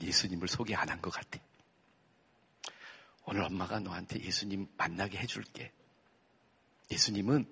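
soft, gentle motherly voice; tender mother. Retold or imitated. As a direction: comfort the crying son softly. (우는 아들을 달래는 부드러운 엄마 목소리)

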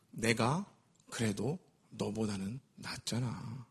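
low, slow smug murmur; smug, quiet. Acted. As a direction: quietly savor feeling superior. Smug inner thought.

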